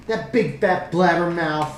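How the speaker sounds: put-on annoyed kid, drawing the words out; irritated little girl. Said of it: "She seems more irritated than anything".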